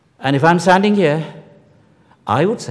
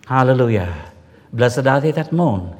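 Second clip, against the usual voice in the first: ordinary talk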